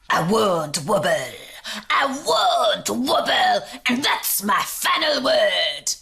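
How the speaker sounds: stubborn, slowed shouting; screaming jelly. A narrator's reading. Jelly refuses the court, screaming defiance. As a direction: dig in like a defiant child. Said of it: "screamed the jelly".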